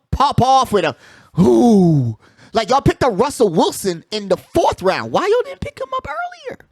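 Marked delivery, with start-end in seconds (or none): 1.4-2.2 mock ooh. Imitating a crowd's surprised reaction. 5.1-6.6 pitched way up, incredulous; exasperated. Real, not acted. Fan teasing the Seahawks, asking in high disbelief.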